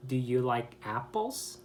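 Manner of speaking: "apples" swept upward at the end; rising intonation. Shown as a demonstration. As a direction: send the pitch up on "apples". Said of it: "Goes up".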